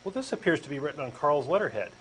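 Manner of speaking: ordinary talk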